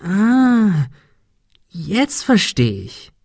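long high "ah", then slow, drawn-out words; dawning realisation. A narrator's reading. Ben finally catches on to Kim's hint, dawning realisation. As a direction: let the penny drop slowly and gladly. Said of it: "Ein breites Lächeln mischte sich in seine Gesichtszüge".

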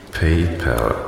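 "PayPal" tried softly with "pell" as second syllable; tentative pronunciation. Acted. Officer guessing at the caller's word, tentative "pay-pell" for "PayPal". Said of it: "wie man das richtig ausspricht".

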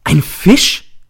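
sudden loud high cry of shock; teacher jumping up, shouting in court; shouted. A narrator's reading. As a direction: leap up and cry it out. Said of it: "Ich springe auf und schreie".

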